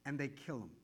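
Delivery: dropped low and soft; quiet. Real, not acted. Preacher to congregation, quiet and low. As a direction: let it fall, softly.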